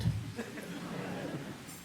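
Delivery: soft unvoiced laugh; breathy laugh. Real, not acted. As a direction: laugh under your breath. Laughing at own joke.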